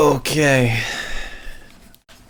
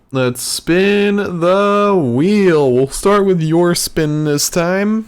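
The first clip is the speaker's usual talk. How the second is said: game show host; 7 semitones higher, much slower than usual. Pretend. Game host spins the wheel.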